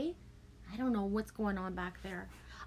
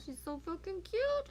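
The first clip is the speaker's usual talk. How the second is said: gushing; 10 semitones higher, 4 dB quieter than usual. Real, not acted. Grandparent gushing over the baby granddaughter, high coo.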